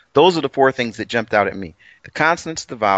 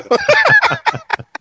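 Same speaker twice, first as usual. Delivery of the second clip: burst of high laughter; 5 dB louder than usual. Real, not acted. Laughs at himself.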